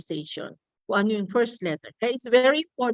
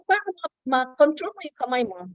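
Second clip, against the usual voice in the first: normal talking voice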